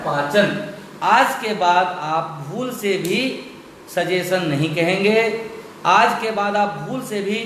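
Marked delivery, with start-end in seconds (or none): none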